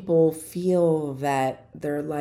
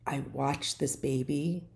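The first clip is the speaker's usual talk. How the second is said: normal talking voice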